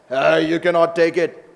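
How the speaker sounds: loud, forceful mock objection; acted stern refusal. Guard refusing Krishna the clothes.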